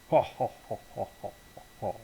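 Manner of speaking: mock evil laugh trailing into breath; villain's laugh. Bad guy threatening the hero's daughter, stagy evil laugh.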